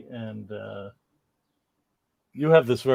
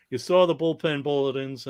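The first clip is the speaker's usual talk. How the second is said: usual speaking voice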